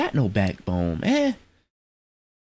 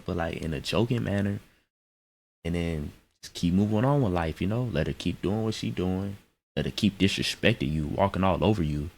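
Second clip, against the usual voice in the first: normal talking voice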